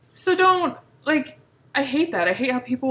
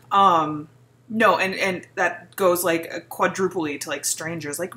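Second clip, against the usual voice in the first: ordinary delivery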